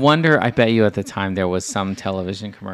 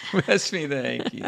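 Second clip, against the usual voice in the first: higher, laughing repeat; laughing echo; 6 semitones higher, 3 dB quieter than usual. Retold or imitated. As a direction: say it through a laugh. Listener echoes with laugh, higher.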